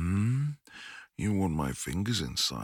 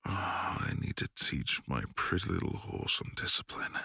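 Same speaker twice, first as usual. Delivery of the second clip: ordinary talk, nothing marked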